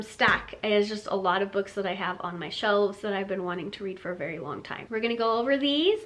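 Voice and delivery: ordinary delivery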